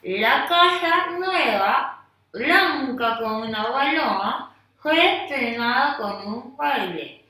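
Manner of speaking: slow, loud, mouth stretched round a pencil. Shown as a demonstration. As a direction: read with a pencil between your teeth. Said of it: "Mira, observa".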